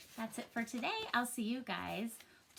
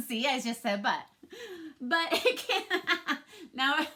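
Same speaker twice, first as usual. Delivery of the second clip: talking through real giggles, louder; can't stop laughing mid-sentence; 3 semitones higher, 6 dB louder than usual. Real, not acted. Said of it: "now I can't say but without laughing".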